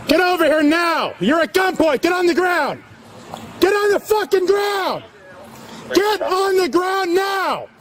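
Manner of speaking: high, forceful police shouting; shouted commands. Real, not acted. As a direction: bark orders at gunpoint. Cop at gunpoint commanding suspect.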